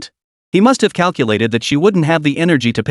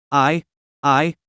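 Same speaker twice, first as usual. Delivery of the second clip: stammering; 3 dB quieter than usual. A narrator's reading.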